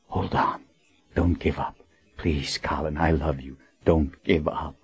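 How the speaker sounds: soft, hushed loving plea; tender plea. Acted. Richard kisses dying Colin's brow, softly pleading. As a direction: murmur it close to her.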